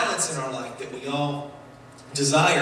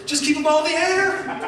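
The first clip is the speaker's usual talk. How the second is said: high, laughing, breezy take; laughing carefree motto; 10 semitones higher than usual. Retold or imitated. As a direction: toss it off with a laugh. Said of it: "my philosophy is".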